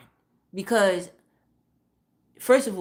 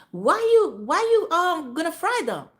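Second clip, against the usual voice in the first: high, louder mocking imitation; mocking the mother-in-law; 8 semitones higher, 4 dB louder than usual. Teller mocking the mother-in-law asking why she fries meatballs, high loud mock voice. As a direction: squeak her question back at her, exaggerated. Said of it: "So she said".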